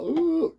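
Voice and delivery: very high, held "ooh" of fright; terrified mock squeal. Imitating the one kid terrified of the gravedigger, mock squeal. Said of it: "terrified of him".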